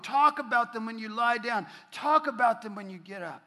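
loud, rising scripture reading from the pulpit; forceful preaching. Acted. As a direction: proclaim it to the whole congregation.

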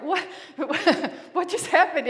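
laughing startled gasp of surprise; mock startled what. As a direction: jump at a sudden presence, laughing.

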